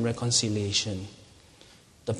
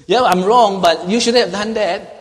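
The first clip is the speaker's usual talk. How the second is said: loud, high mock retort; blame shifting; 7 semitones higher, 11 dB louder than usual. Preacher imitating a grudging admitter blaming the other, blame shifting. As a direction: throw the blame back, much louder. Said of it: "we give excuses".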